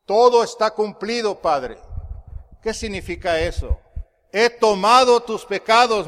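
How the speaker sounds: sermón fuerte y enfático; prédica a toda voz. Real, not acted. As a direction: proclamar con fuerza ante la congregación. Preaches at full force. (predicación enérgica, a pleno pulmón)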